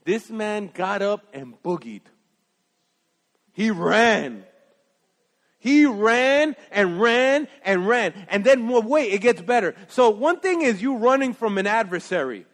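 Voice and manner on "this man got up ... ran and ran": drawn out. Real, not acted. Preacher dramatizes Elijah fleeing.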